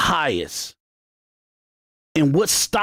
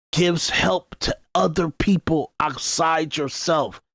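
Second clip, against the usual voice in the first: usual speaking voice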